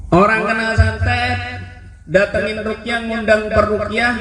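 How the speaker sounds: high, emphatic telling-off; lecturing. Retold or imitated. Kiai lecturing the witch's grandchild, high and firm. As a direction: lay it out firmly, pitched high.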